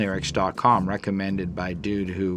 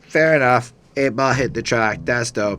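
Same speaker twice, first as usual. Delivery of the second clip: mock approval; 3 semitones higher, 5 dB louder than usual. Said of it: "I'm like".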